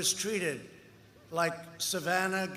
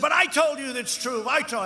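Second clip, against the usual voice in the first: higher, louder insistent protest, retold; mock screaming interviewer; 6 semitones higher, 6 dB louder than usual. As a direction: imitate her yelling back at him. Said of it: "Where she's screaming".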